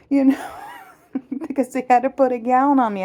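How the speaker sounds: amused, laughing explanation. Real, not acted. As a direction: giggle while explaining. Laughing at own story.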